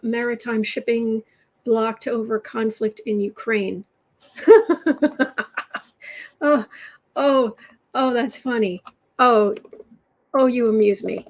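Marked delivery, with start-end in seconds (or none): 4.4-5.8 loud, higher, fake haughty laugh; a sarcastic burst of laughter; mocking laugh. Mocking reaction to Putin news. 6.5-11.2 louder, sarcastic mock amusement; mock amused. Sarcastic reply to Putin news.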